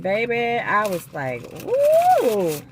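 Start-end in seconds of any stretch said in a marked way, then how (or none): 1.6-2.6 loud, high joyful whoop; excited whoop. Real, not acted. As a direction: let out a big happy woo. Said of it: "I'm so excited".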